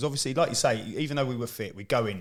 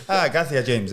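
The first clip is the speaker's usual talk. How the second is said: laughing mock Spanish; 3 semitones higher, 5 dB louder than usual. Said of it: "you're like".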